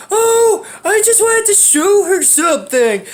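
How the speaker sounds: high, slow put-on voice for a lame excuse; mocking imitation. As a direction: mimic the culprit's silly excuse.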